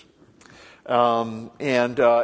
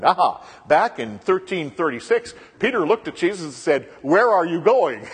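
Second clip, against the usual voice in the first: a mock gotcha voice, slowing and louder on the question; mocking critics; 5 semitones higher, 6 dB louder than usual. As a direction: imitate a smug critic catching a mistake. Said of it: "And people said".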